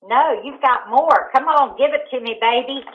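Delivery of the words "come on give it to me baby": normal talking voice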